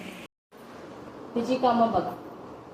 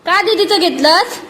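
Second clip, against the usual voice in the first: slow, loud, higher "what did you do"; loud scolding; 7 semitones higher, 5 dB louder than usual. Real, not acted. Mother loudly scolding the toddler for taking her sister's thing.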